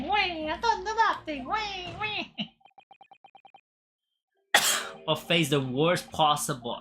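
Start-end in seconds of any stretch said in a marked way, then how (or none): 0.0-2.5 very high sobbing wail; much higher blubbering voice; whimpering Italian crook. Acted. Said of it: "There's so many accents".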